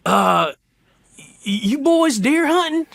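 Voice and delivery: high, drawn-out, hesitant question; imitated older gentleman. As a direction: put on an older hunter's high voice. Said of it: "the older gentleman".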